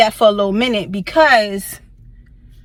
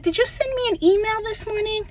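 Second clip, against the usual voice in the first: sweet fake politeness; 11 semitones higher, 4 dB quieter than usual. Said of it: "I'm like".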